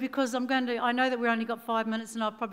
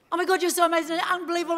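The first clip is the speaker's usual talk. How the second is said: higher and louder, gushing fan; mock-starstruck rush of praise; 6 semitones higher, 5 dB louder, much faster than usual. Singer meeting Nelson Mandela, gushing starstruck praise. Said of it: "And I went".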